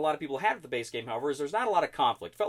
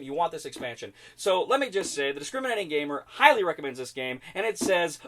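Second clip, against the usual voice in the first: ordinary talk, nothing marked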